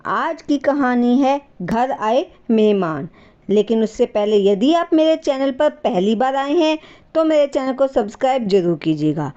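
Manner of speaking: ordinary delivery, nothing marked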